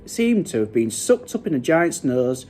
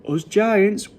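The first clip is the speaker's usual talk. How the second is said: usual speaking voice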